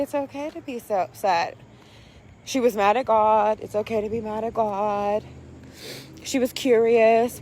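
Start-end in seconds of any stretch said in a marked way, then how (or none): 0.0-1.6 soft, comforting mother's voice; gentle nurturing. Retold or imitated. Mom holding her crying daughter, soft nurturing reassurance. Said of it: "hold her and nurture her and say". 3.6-5.2 low-key, soft reassurance; gentle nurturing. Retold or imitated. Mom nurturing daughter who was mad at God, soft reassurance. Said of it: "hold her and nurture her and say".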